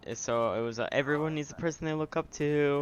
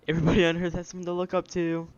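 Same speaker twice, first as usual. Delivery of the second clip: louder, higher recited verse; loud recital; 5 semitones higher, 6 dB louder than usual. Shown as a demonstration. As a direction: belt the line out like a chant. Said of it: "Wait, I'm gonna".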